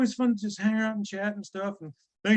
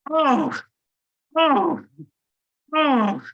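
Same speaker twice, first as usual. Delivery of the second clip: ordinary talk, nothing marked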